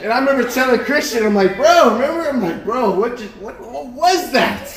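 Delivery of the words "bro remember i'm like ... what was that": high, astonished retelling; acted-out baffled outburst; retold bewilderment. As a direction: blurt it to a friend in disbelief. Retold shocked question to friend.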